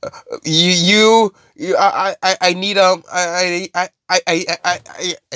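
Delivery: high, halting stammer; scared stutter. Retold or imitated. Terrified guy trying to sing, scared stutter. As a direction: stammer over every word in acted fear. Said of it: "'cause he's so scared".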